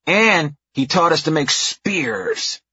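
put-on slow, proud child voice; drawn-out bragging kid; little girl voice. A narrator's reading. Narrator voicing a little girl.

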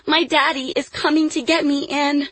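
put-on high-pitched little girl's voice; insist, high and sustained; child's high protest; crying out. A narrator's reading. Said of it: "Lily cried".

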